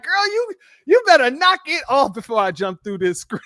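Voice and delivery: high-pitched, playful threat breaking into a laugh; hammed-up excited plea; smitten mock warning. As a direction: warn her off as if you can't take it. Acting out his smitten reply.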